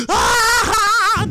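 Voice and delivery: high yelled "Ah!" of laughter. Shown as a demonstration. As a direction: yell a laugh right at him. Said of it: "just yell, laugh at him".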